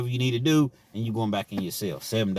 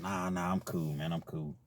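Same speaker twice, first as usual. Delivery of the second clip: softer, low reluctant no; quietly declining, fading out; quiet refusal; 4 semitones lower, 9 dB quieter than usual. Retold or imitated. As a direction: turn it down against your hunger. Hungry refusal.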